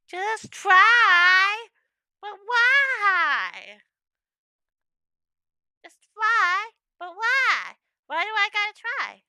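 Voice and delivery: high, loud whining protest; whiny. Acted. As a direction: whine like a stubborn kid. Whiny refusal to sing.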